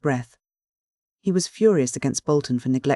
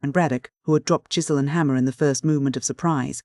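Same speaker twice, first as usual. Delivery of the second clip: usual speaking voice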